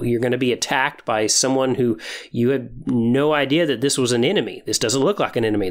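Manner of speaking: ordinary delivery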